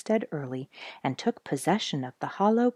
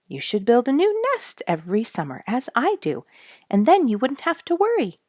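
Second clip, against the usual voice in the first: higher, louder breezy robin voice; cheerful, thoughtless bird, pitched up and rising; jolly careless robin; 8 semitones higher, 5 dB louder than usual. A narrator's reading. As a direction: toss off the advice like a carefree robin. Thoughtless Robin gives advice.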